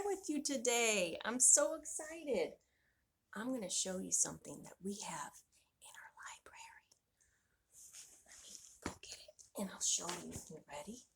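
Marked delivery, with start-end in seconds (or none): 3.4-10.3 put-on secretive whisper to the kids; teasing whispered build-up. 10.5-11.0 hushed. Acted. Hushed teaser before reveal.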